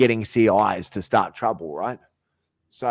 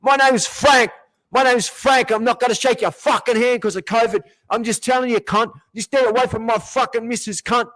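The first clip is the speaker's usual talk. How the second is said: high, whiny put-on voice of an aggressive bloke; mocking impression; 13 semitones higher, 4 dB louder than usual. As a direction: parody the guy to make him sound ridiculous.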